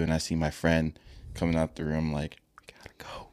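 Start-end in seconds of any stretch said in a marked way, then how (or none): 2.6-3.3 barely audible urgent whisper; hushed, voiceless aside; retold whisper. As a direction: imitate a friend whispering to slip away. Friend sneaking out of the room.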